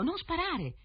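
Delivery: high-pitched cry of alarm; urgent, high fish's shout. A narrator's reading. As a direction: call out high to stop the shot. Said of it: "il pesce gridò".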